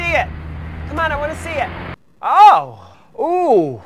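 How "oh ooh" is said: loud startled exclamation; loud dismayed oh; shocked gasp. Acted. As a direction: recoil at the mirror. Shock at haircut.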